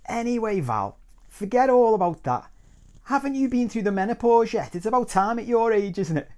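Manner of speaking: loud, high-pitched meddling aunt; provocative interruption. Retold or imitated. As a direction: cut in loudly to wind her up.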